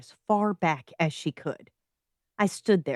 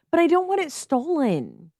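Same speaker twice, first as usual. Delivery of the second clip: whiny; 9 semitones higher than usual. A narrator's reading. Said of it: "she whined".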